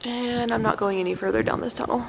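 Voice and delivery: usual speaking voice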